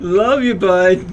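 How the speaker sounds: hammed-up loud affection with 'Love you, bud' thrown high; mock loud love you. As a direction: blast 'Love you, bud' across the room in a teasing tone. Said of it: "that was spot on".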